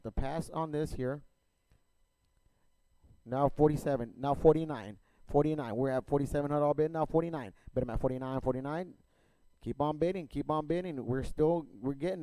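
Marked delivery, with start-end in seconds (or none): 3.2-8.9 rapid rhythmic auction chant; auctioneer. Real, not acted. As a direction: rattle off bids like an auctioneer. Auction chant bidding calls.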